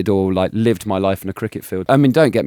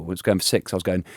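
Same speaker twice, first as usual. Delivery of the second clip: ordinary delivery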